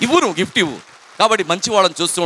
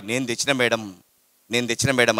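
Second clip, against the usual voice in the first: put-on small boy; 6 semitones lower, 4 dB quieter than usual. Boy answers the teacher softly.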